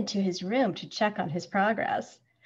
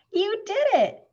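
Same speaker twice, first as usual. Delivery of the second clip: high, louder cheer; exclaiming; 12 semitones higher, 6 dB louder than usual. A narrator's reading. Mom finds Schubert dressed for school, exclaiming. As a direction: exclaim it proudly, pitch leaping up. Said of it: "she exclaims".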